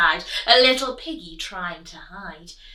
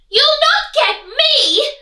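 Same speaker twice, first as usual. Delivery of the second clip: high, loud, slow defiant pig; piggy crying out; 14 dB louder than usual. Acted. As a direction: taunt the wolf from safety. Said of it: "the piggy cried".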